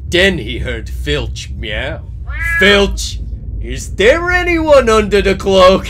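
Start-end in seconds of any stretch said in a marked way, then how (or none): 2.6-5.9 loud, high-pitched character yell; calling out. A narrator's reading. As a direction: shout the question high and loud. Norris calls out to his cat.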